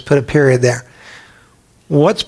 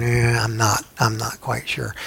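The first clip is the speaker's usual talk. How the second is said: softer, trailing, unsure hedge; hesitant mumble; 4 semitones lower, 8 dB quieter than usual. Retold or imitated. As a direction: back off the commitment under your breath. People backing away from Rome.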